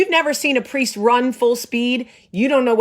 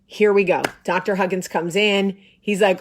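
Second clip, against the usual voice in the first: usual speaking voice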